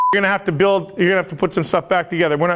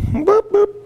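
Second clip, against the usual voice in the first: silly boops. Acted. Silly noise.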